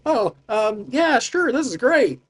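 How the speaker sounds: high-voiced, eager-to-agree character; Mel's character voice. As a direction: pitch it way up, hesitant then agreeable. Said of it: "picture how their voices would be".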